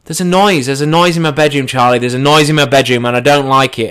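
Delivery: high, loud, fretful complaint; agitated, insistent retold protest; worked up. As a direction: act out mounting panic to a friend. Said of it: "starting to get all worked up".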